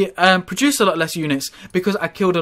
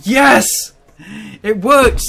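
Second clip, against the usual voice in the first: high, loud triumphant cry; excited shout; 6 semitones higher, 7 dB louder, much slower than usual. Real, not acted. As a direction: yell out the sudden win. Surprise win in a game.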